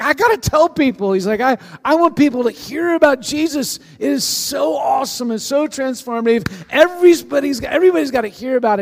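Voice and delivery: like he's about to explode with good news; bursting excitement. Retold or imitated. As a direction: Philip overjoyed, shouting that everyone must hear. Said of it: "he is like exploding inside".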